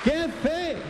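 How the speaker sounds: high-pitched mock exclamation of disbelief; incredulous onlookers. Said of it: "Y la gente decía".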